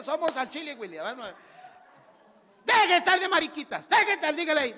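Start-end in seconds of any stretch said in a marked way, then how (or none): 2.6-4.3 loud, high scolding shout; hammed-up tough-love yell; forceful. Shown as a demonstration. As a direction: bark a telling-off. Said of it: "pero fuerte".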